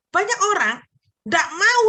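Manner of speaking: ordinary delivery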